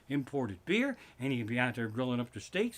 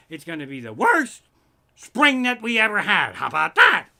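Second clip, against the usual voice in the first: shouted rising rant, much louder; 5 semitones higher, 11 dB louder than usual. Real, not acted. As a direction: yell the punchline at the crowd. Ranting punchline about bad spring.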